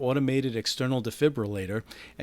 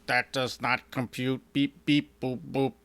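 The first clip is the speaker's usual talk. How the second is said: lightly voiced retro sci-fi computer, mock robotic; a hint of a beeping sixties robot dodging the question; fake sixties computer voice. Robot fakes a sixties computer voice to dodge blame, lightly robotic. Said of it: "obviously fake, stereotypical nineteen sixties sci-fi talking computer voice".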